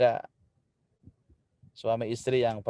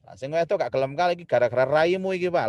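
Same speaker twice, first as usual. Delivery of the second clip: menyalahkan dengan logat Jawa Timur, nada tinggi; logat Surabaya marah; 3 semitones higher than usual. Retold or imitated. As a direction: bertengkar ala Surabaya. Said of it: "bahasa-bahasanya muncul semua". (marah-marah dengan logat Surabaya)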